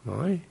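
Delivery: "Mai" softly lifted like a question; soft rising "Mai". Shown as a demonstration. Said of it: "That sound means a question".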